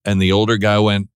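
usual speaking voice

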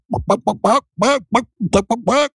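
high-pitched homemade chicken clucking; chicken impression. Shown as a demonstration. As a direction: cluck like a hen in short, high bursts. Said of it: "It's my chicken impression".

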